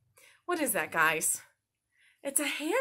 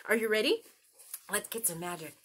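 ordinary talk

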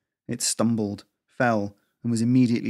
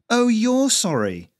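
higher, louder sarcastic snap; snapping; 12 semitones higher, 7 dB louder, slower than usual. A narrator's reading. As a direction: snap it back, stretched and scornful. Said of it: "Lisa snapped".

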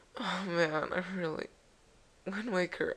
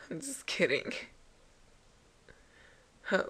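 usual speaking voice

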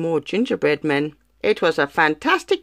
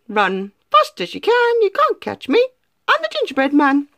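high, quick teasing chant; sing-song gingerbread man boast; cheeky gingerbread man; 12 semitones higher than usual. Acted. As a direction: taunt them as you run off.

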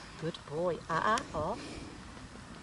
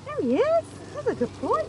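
cooing greeting; 6 dB louder, faster than usual. Real, not acted. Greeting dog in pet voice.

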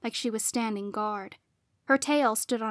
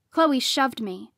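plain reading voice

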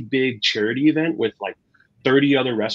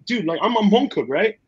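much higher, animated mock-panic plea; exasperated plea; 10 semitones higher than usual. Home cook to a restaurateur, pleading in high mock panic. Retold panic at fancy wine dinner.

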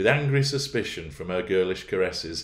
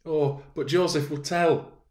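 put-on higher, slow girlish suggestion; 9 semitones higher, 4 dB louder than usual. A narrator's reading. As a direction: coax him gently. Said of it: "she suggested".

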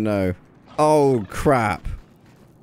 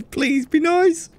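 nervous pleading; slower than usual. Real, not acted. Pleading with attacking creature.

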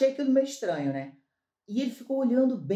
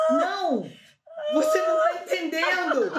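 panicked protest; 7 semitones higher than usual. Retold or imitated. To the young man on the plane, a panicked laughing protest. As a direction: insist in a rush of retold panic. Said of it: "aí eu entrei em pânico".

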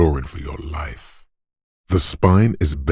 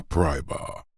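softer, falling repeat; hushed echo; 6 dB quieter than usual. Acted. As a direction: say it softly, like an afterthought. Softer echo after rapturous call.

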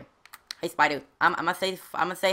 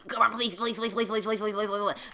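higher-pitched, rapid-fire begging; frantic pleading; 5 semitones higher, 4 dB quieter than usual. Real, not acted. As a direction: plead urgently, the same word tumbling out over and over.

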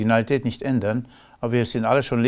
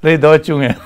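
much louder, laughing comeback; laughing retort; 3 semitones higher, 8 dB louder, slower than usual. Retold or imitated. His reply to the local, loud and laughing. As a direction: fire it back with a grin. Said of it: "Ich sag".

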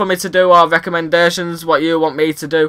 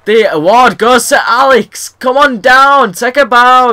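drawn-out, higher pitched show-host voice; mock award announcer; 6 semitones higher, 4 dB louder, slower than usual. Gamer handing himself an award, mock announcer. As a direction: play at announcing a winner with relish.

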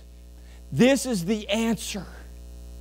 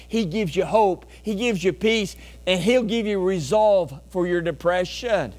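normal talking voice

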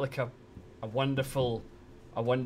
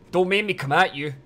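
mock threat; 6 semitones higher, 10 dB louder than usual. Mock threat to chat members.

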